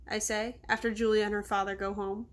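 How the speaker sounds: ordinary delivery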